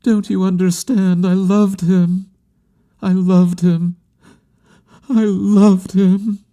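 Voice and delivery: high, impassioned plea; insistent grieving woman. A narrator's reading. Woman insisting she loved him, high and anguished. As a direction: repeat it with mounting ache, slowing on the last. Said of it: "she insisted".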